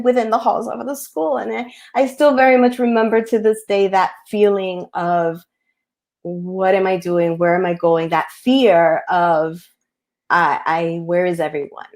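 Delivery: ordinary talk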